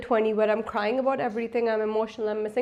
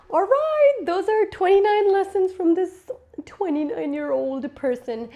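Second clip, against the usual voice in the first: cheery sign-off; 8 semitones higher, 5 dB louder than usual. Real, not acted. Upbeat sign-off.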